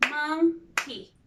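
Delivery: "monkey" as two separate beats; split into syllables. Shown as a demonstration. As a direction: break "monkey" with a pause: mon, key. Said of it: "if we split the word, it will be".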